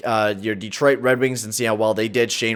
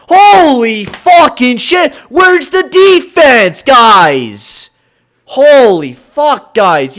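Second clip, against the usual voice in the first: slow, high and loud yelling; furious shout; 11 semitones higher, 10 dB louder, much slower than usual. Real, not acted. Gamer shouting at his players about the defense, raging. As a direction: bellow in disbelief at the team.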